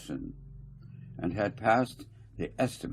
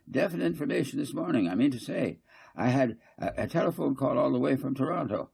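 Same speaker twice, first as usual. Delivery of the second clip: fussy councillor Garth; 7 semitones higher, faster than usual. Acted. Garth lecturing the council, higher and insistent. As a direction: press the point, repeating I mean to say.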